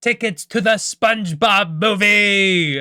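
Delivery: high, loud, slow mock proclamation; triumphant captain. Captain holds up the treasure to the crew, triumphant shout. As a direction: bellow the prize to the whole crew, stretched out. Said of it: "pulls it up and he's like".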